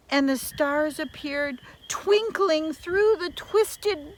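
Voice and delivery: a lively read-aloud fairy tale voice; storybook narration. A narrator's reading. Reading a fairy tale to children, storybook narration. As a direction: tell it like a bedtime tale.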